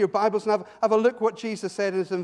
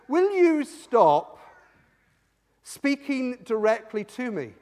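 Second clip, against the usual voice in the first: high, loud, drawn-out opening, then firm; exasperated demand; 4 semitones higher, 5 dB louder, slower than usual. Retold or imitated. Gang member tells preacher to stop, exasperated. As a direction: spell out the demand slowly, word by word.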